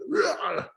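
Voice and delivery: high-pitched Japanese-style pitcher's yell; imitated pitcher's grunt. Imitating Ohtani throwing the ball, high effort yell. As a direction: let out a mock pitching roar. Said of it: "it's that Japanese sound".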